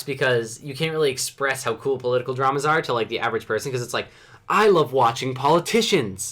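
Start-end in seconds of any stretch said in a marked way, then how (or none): 4.5-6.3 slow, emphatic declaration of love; mock enthusiasm. Explaining political dramas to the average person, mock enthusiasm. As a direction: draw it out like a hammed-up fan.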